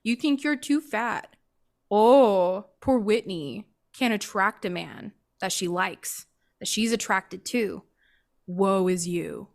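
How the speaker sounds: self-mocking, drawn-out fake sympathy; mock pity. Making fun of her own insecurity, mock pity. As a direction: pity yourself sarcastically, laying it on thick. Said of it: "started to make fun of myself".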